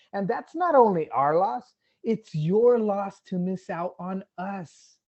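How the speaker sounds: rallying a crowd with rising force; impassioned appeal. Real, not acted.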